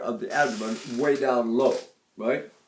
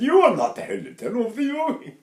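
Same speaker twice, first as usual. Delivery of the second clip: a higher, lightly put-on voice of an old voice teacher; lightly voiced stern maestro; imitating his teacher; 8 semitones higher than usual. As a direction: mimic the great man's put-down. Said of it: "and he said".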